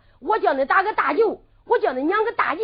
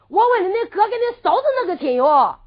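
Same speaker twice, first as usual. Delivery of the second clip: 提高嗓门追问; 6 semitones higher, 7 dB louder than usual. Acted. 丫鬟追问.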